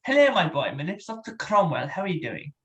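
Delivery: London voice actor character; Doctor Cromwell. Acted. Showing his own made-up character to a stranger, London voice actor. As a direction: greet them as a theatrical Londoner. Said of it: "he's a voice actor from London".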